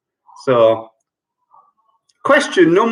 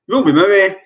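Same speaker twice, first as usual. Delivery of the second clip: ordinary talk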